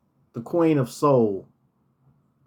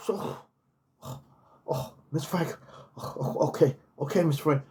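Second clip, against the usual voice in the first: flustered in the heat; 6 dB quieter than usual. Retold or imitated. Overwhelmed by heat, sweating.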